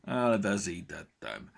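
slow, mildly downcast reply; a touch of drawn-out dejection; dejected. A narrator's reading. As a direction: own up to a loss, glumly. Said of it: "lógatta a manó piros orrát".

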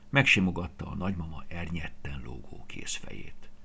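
ordinary delivery

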